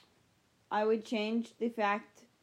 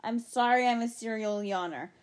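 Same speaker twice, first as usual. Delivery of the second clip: ordinary delivery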